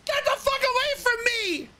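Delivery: very high, hysterical shriek; terrified scream. Retold or imitated. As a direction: scream to drive off an attacker. Crying stranger screams at him.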